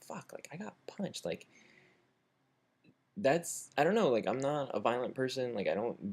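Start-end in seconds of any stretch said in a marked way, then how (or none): 0.0-1.2 high, soft, hurt whine; upset whimper. Retold or imitated. Punched man back with his food, high hurt whimper. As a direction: imitate a small, wounded complaint. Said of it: "I started to get really upset".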